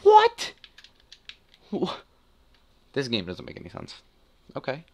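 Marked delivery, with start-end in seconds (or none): 0.0-2.0 much higher, loud incredulous "What?"; squeaky disbelief. Real, not acted. Gamer stunned by the match, squeaky disbelief. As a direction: pitch it way up in disbelief.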